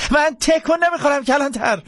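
high-pitched frightened yell; panicked reply. Acted. Jackson obeying the marshal, high panicked yell.

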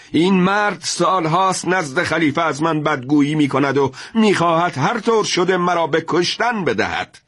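high, raging outburst; angry shout. A narrator's reading. Afshin refuses the judge's plea, raging shout. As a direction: hurl the accusations in fury. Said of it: "افشین فریاد زد".

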